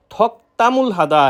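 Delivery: থকতামুল slowly, with t in the middle, not d; slow teaching demo of থকতামুল; slow correct pronunciation. Shown as a demonstration. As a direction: spell out থকতামুল হাদা slowly. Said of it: "থকতামুল উচ্চারিত হবে".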